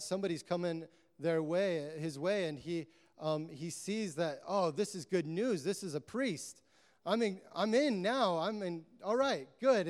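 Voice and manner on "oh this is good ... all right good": higher-pitched, mildly excited relief; hopeful relief. Retold or imitated. Wounded man thinking rescue has come, hopeful relief. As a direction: voice rising with new hope. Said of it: "he starts to get hope".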